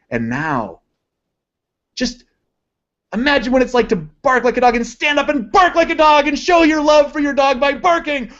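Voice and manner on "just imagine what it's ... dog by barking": much higher, carried-away hypnotist voice; mock stage hypnotist egging on a volunteer; stage hypnotist command. Shown as a demonstration. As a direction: sweep them into barking like a showman.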